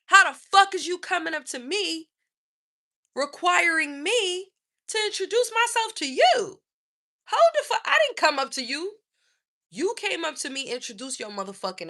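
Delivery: high, loud outraged rant; furious rant. Real, not acted. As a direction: tear into him, fully outraged. Ranting at rude man.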